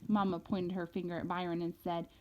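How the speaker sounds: plain reading voice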